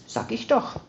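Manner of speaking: ordinary delivery, nothing marked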